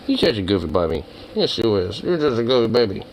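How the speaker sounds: tender pet talk. Real, not acted. Baby talk to the dog.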